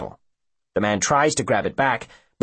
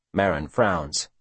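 ordinary delivery

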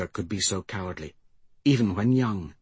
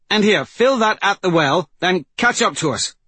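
shouted order; 9 semitones higher, 5 dB louder than usual. A narrator's reading. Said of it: "Wraith shouted".

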